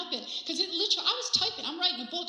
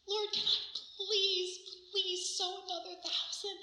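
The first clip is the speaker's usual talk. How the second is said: slow, high-pitched begging; eager pleading; 7 semitones higher, 3 dB quieter, slower than usual. Acted. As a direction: implore with a drawn-out "please". Eager begging prayer, acted.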